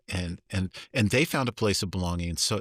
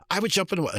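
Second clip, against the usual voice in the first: put-on high, eager child's answer; eager kid; 10 semitones higher, faster than usual. Child answering the teacher's question, eager. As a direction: blurt it out like a keen kid.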